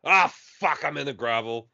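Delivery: lightly Australian frustrated racer; Aussie gamer voice. Retold or imitated. Captain Smash crashing out, light Australian. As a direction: groan it like an Aussie in the gravel. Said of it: "he's like".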